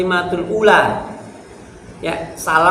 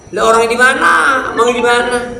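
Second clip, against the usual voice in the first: loud, rude high call; 7 semitones higher, 6 dB louder than usual. Retold or imitated. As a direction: shout rudely without turning. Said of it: "Itu namanya songong".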